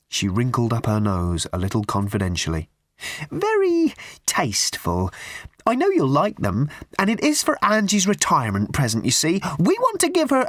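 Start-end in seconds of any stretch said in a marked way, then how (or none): 3.3-10.5 put-on a prim, simpering old charity lady pushing tickets; high twittering church-fete lady; raffle woman.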